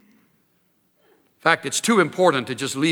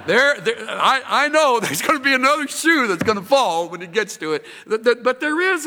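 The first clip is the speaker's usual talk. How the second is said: nervous laugh; 6 semitones higher, 4 dB louder than usual. Retold or imitated. Worried employee, laughing.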